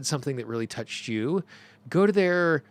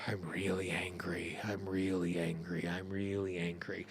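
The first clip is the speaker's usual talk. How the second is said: softer, lower, turned inward; quiet inward repetition; 6 semitones lower, 8 dB quieter than usual. Shown as a demonstration. Tapping teacher demonstrates softly owning her anger. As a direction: murmur it to yourself as you sit with the anger. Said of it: "You know".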